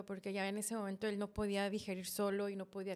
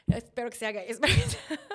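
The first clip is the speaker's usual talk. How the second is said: louder, rising into laughter; laughing joke; 10 dB louder than usual. Retold or imitated. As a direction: joke it out loud and crack up. Abrazando a José, bromea. (broma riendo, más fuerte)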